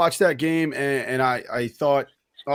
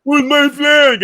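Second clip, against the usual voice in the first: whiny Tom Brady; 13 semitones higher, 9 dB louder, much slower than usual. Retold or imitated. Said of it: "this baby back bitch doesn't turn around and go".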